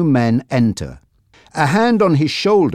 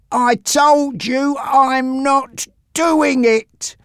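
high, very slow, effortful refusal; struggling to speak; 13 semitones higher, much slower than usual. A narrator's reading. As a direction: force the words out through pain. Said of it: "he managed to say".

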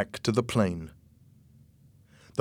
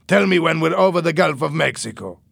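higher, louder gangster voice; gruff smuggler; 6 semitones higher, 6 dB louder than usual. Acted. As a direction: give a curt command. Louis voiced.

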